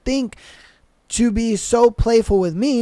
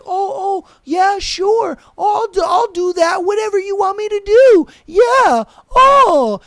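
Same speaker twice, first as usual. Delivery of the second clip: squeaky high mock yes-man, getting louder; mocking a pushover; 9 semitones higher, 5 dB louder than usual. Podcaster mocks the boyfriend as a high squeaky pushover. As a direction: play the doormat boyfriend in a high, loud squeak. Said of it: "your monkey ass is just like".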